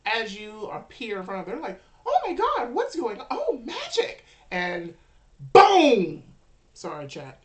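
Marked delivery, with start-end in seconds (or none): 2.0-4.2 high, loud, hammed-up crowd amazement; amazed onlookers. Game master voices a crowd seeing magic, high amazed cries. 5.5-6.2 huge, loud vocal boom; booming gunshot imitation. Game master narrates the gun going off, explosive shout.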